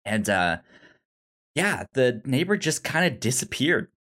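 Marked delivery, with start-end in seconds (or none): none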